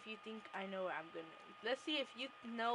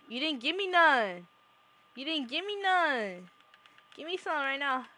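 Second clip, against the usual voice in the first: loud demand; 12 dB louder than usual. Real, not acted. Demanding a gift loudly.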